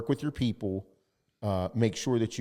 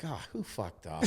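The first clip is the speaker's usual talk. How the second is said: much softer, deflated question; weary groan; 10 dB quieter than usual. Retold or imitated. Owner reacting to a partner's public mistake, deflated. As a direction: sigh it out, resigned.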